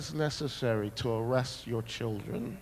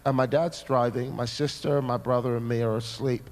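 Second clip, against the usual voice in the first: ordinary talk, nothing marked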